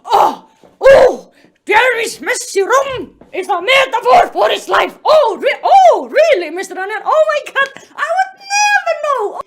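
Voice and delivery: loud, high sarcastic astonishment; mock revelation. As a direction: shout it like a huge fake discovery. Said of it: "You don't have to be like".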